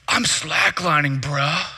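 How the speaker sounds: slow, drawn-out stoner-style reply; laid-back dude. Retold or imitated. Slackliner answers the puzzled student, laid-back dude voice. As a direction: imitate an unbothered slackliner. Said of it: "Bro, you need the opposite of a chill pill".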